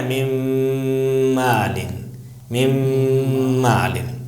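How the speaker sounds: من مالن as "mimmm-aalin", long hummed M; ghunna merge. Shown as a demonstration. Teacher showing correct reading, من مالن with long nasal "mimm". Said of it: "آپ کو پڑھنا ہے".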